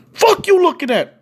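high-pitched, aggressive challenge; hostile challenge. Retold or imitated. New Yorker to staring out-of-towner, hostile. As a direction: snap back at a staring stranger. Stared-at stranger snaps back.

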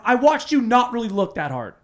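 high, loud acted accusation; retold exasperated protest; panicked, angry. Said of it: "now I'm, like, panic, angry".

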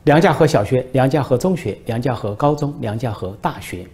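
ordinary talk

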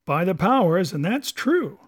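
emphatic pirate cry; cried out. Acted. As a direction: cry out in agreement. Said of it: "cried Silver".

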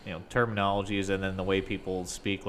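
normal talking voice

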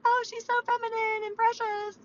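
very high, mock-precious squeal; sweet girl. Tomboy host mocking feminine voices, very high sweet coo. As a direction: coo it way up high, hammed-up girly. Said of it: "like a sweet girl".

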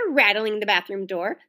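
ordinary delivery, nothing marked

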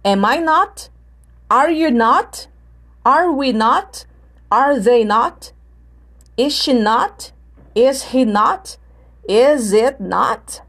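slow model questions with rising ends; measured drill, each "not" swinging upward; questioning intonation. Shown as a demonstration. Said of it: "uma pergunta com not".

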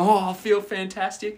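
mock swagger while flexing; smug gym bro.

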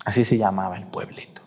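soft unvoiced whisper, half to himself; whispered aside. Real, not acted. Preacher whispers the town's name to the congregation, whispering. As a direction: drop to a breathy whisper as an aside.

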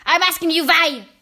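loud, high witch outburst; screamed demand. A narrator's reading. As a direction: demand it at a shout. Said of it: "she screamed".

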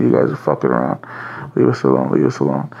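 low, drowsy mumble of someone just woken; sleepy groggy brother. Retold or imitated. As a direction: grumble like you were dragged out of bed.

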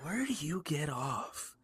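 slow whispered mock indignation; slow joking whisper. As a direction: hiss it under your breath, joking.

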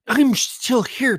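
high, slow mock reply; lightly hammed-up eager rider. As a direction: parody the rider talking back to the screen. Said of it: "When I do this voice, it means I'm mocking them".

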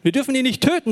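höher und lauter gerufen; laut rufend. Acted. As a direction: Ruben ruft flehend dazwischen. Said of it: "rief der". (Ruben ruft laut, um Josef zu retten)

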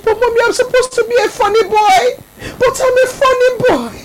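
put-on high, loud, whiny child sobbing; crying little boy. Son tearfully pleading with his mother that it was a joke, high and crying. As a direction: plead through tears like a small boy.

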